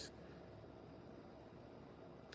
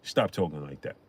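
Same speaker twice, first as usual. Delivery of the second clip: low, softer calming warning; 6 semitones lower, 7 dB quieter than usual. Retold or imitated. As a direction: tell them to cool it, quietly. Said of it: "Puff called the studio and was like".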